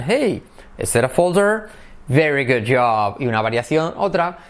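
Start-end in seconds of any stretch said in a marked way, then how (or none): 0.0-1.7 high "hey" leading into the question; "hey" pitched up before "folder"; intonation demo. Shown as a demonstration. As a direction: start with a lifted "hey" then rise. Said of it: "Entonación".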